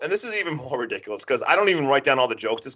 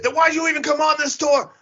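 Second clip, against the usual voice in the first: high, exasperated demand; imitating an irritated tour guide; mock pissed; 11 semitones higher than usual. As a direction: throw it out high, annoyed at the customer. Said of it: "and then he's pissed".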